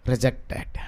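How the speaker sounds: very soft and low; muted inner verdict. Retold or imitated. Offended member silently rejects the preacher's word, low and hushed. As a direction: drop to a low, quiet aside.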